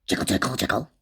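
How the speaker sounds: a teapot lid jiggling in a low voice; lid's low jiggling chant. Acted. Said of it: "made it say".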